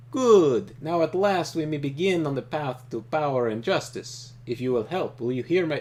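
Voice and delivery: loud, stately warlord reading; Trakov voice. Acted. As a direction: give it weight like a villain's speech.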